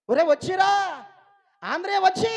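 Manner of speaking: high, loud cry of a man caught; imitated yell, pitched high; acted loud yelling. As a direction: call out loudly as the struggling swimmer. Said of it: "గట్టిగా అరుస్తున్నాడంట".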